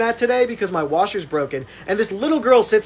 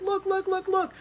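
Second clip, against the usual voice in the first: mock exasperated plea, pitched up; pleading narrator; 8 semitones higher than usual. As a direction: beg the mom to play with her kid. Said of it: "points at the iPad and is like".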